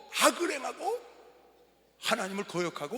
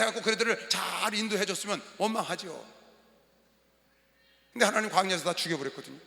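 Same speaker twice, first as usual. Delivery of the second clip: ordinary talk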